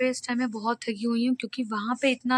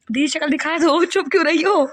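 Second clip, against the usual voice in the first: higher, louder playful coaxing of sister; teasing call; 3 semitones higher, 5 dB louder than usual. Real, not acted. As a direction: tease her into showing her face. Teasing sister to show face. (दीदी को छेड़ते हुए ऊँची आवाज़)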